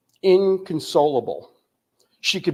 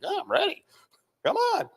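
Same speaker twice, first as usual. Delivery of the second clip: protesting; 4 dB quieter than usual. Retold or imitated. Protesting to the counselors.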